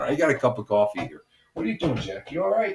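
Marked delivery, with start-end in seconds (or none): 1.5-2.7 talking to the dog. Real, not acted. Talking to his dog Jack.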